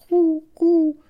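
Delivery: very high squeaky bird voice; pigeon voice. Pretend. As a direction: coo like a prim pigeon. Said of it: "The pigeon's like".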